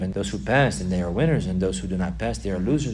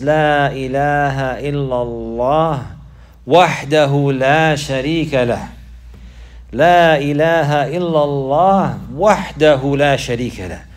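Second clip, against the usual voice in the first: loud, raised stress on "لا إله إلا الله" and "وحده لا شريك له"; emphatic declaration; 4 semitones higher, 8 dB louder than usual. Retold or imitated. Teacher showing how the Prophet would emphasize, emphatic. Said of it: "rather he'll emphasize".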